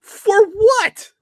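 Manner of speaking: can't believe it, for what; incredulous. Real, not acted. Incredulous reaction to story.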